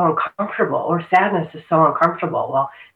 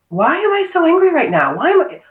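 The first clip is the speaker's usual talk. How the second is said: higher, exasperated "why am I so angry"; mock frustrated self-talk; 12 semitones higher than usual. Guest imitating people who overthink their anger, higher and exasperated. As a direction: play someone fretting over their anger, pitched up.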